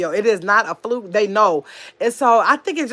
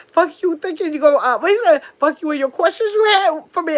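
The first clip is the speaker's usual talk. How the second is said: imitating the uncle cussing, pitched up; mock cussing out; 8 semitones higher, 3 dB louder than usual. Niece retells her uncle cussing her out, mock angry. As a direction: snap it back like the uncle did, higher. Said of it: "the way he just cussed me out".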